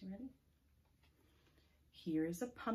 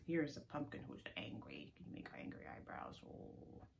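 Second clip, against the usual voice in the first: ordinary talk, nothing marked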